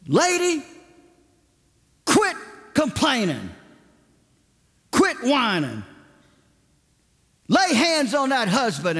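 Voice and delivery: high, emphatic mimicked scolding; impatient rebuke. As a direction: imitate a preacher father telling someone off. Acts out impatient father's rebuke.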